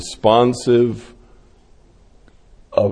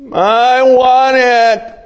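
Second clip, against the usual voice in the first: put-on much higher, slow drawn-out kid's whine; whining child; 13 semitones higher, 4 dB louder, slower than usual. Preacher voicing child whining at parent, high and slow. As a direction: stretch it out like a spoiled kid.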